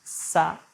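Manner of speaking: Sat said as one open syllable, a slightly lifted sah-t; Sat voiced as the in-breath mantra. Shown as a demonstration. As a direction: give the mantra word Sat clearly and slowly. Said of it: "thinking quietly to yourself, when you breathe in".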